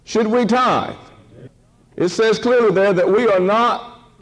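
high-pitched, emphatic pulpit exhortation; fervent preacher, punching key words; preaching. Real, not acted.

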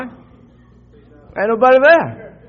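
drawn-out, high, loud holler; exuberant shout. Shown as a demonstration. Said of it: "you go in there and shout".

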